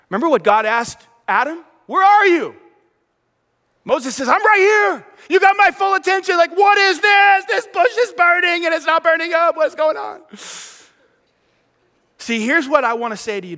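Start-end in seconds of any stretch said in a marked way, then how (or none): 1.9-2.6 God calling. Retold or imitated. God asking Adam. 4.3-10.2 high, loud and eager; bursting with wonder; excited Moses. Retold or imitated. Moses answers God excitedly.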